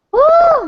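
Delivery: high, loud relieved woo after the laughing fit; loud exhaled woo. Real, not acted. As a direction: let out a big whoop of breath.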